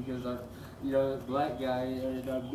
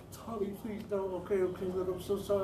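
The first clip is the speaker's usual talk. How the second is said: pleading; 5 semitones higher than usual. Retold or imitated. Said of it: "Whatever, she fucking folded".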